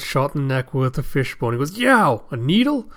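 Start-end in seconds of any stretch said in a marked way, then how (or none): 1.8-2.9 high, slow acted pain cry; yelp, then puzzle at the sting; startled yelp. Character hit in the neck.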